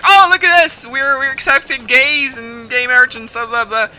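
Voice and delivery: high, loud mock boasting; put-on "look at us" voice, pitched well up; mocking self-congratulation. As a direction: parody a show-off fishing for applause. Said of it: "they were like".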